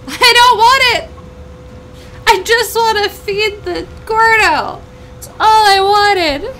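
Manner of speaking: high, loud panicked wail, slowing down; panicked yelling. Real, not acted. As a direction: shout in mock despair while fleeing. Fleeing from a fire slime.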